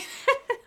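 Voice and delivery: high, sudden giggle; laughing. Real, not acted. Host cracking up at her own mafia impression, laughing. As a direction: burst out laughing at yourself.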